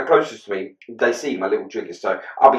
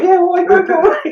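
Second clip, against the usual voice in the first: much higher, louder squeaky no; squeaky caving in; 7 dB louder than usual. Retold or imitated. Giving in to his missus, high squeaky. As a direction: cave in like a scolded boy.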